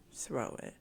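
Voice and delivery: whispered, dismissive "Throw it."; whispered toss. Shown as a demonstration. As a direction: breathe it out like tossing paper away. Tossing away old pain.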